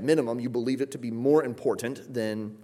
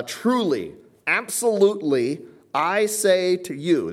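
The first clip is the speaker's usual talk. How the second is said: high, loud, slow, every word weighted; booming rise on "absolutely", then slow "I say to you"; emphatic proclamation; 8 semitones higher, 6 dB louder than usual. Shown as a demonstration. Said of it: "This is noted for emphasis".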